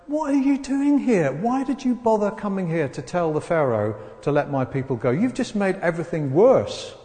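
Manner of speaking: acted exasperated crowd, loud and high at first; angry complaint. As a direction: berate him, starting high and loud. Acted slaves berate Moses.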